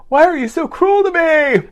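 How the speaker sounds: high, loud wounded whine; mock hurt squeal; hurt reply. Someone hurt by a mean text.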